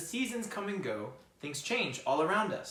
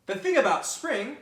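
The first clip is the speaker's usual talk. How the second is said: higher, louder rabbit voice on thing; grumpy bunny starting a complaint; rabbit complaining; 8 semitones higher, 11 dB louder than usual. Acted. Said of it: "said Rabbit".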